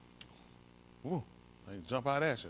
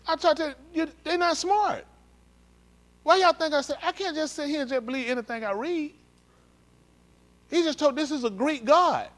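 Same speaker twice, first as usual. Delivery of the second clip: much higher and louder, preaching hard; full-force preaching; 12 semitones higher, 8 dB louder than usual. Real, not acted. Preacher driving the point home to viewers, much higher and louder. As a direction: lift it up and preach it to them.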